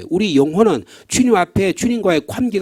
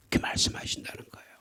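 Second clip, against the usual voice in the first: whisper; 12 dB quieter, faster than usual. Acted. Whispered aside after quote.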